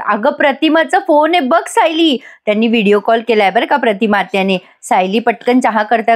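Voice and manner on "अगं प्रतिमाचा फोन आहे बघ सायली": higher-pitched, retold call. Grandmother calls Sayali to the phone, mock calling out. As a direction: lightly call her over, pitch raised. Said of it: "Purnaji सायलीला आवाज देऊन सांगतात".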